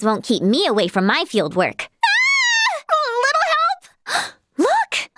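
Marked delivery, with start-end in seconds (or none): 2.0-2.8 high, piercing shriek; startled scream. Acted. Sudden fright while searching in darkness. 2.9-5.1 high-pitched, panicky call for help; alarmed plea. Acted. In trouble, calling for help.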